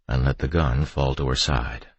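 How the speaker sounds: usual speaking voice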